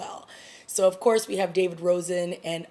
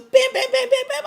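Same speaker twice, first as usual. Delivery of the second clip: much higher, loud punched bam sounds; loud stabbing bams; 8 dB louder, faster than usual. Retold or imitated. Describing the villain's stabbing, she shouts each bam. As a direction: hammer out each stab as a sound effect.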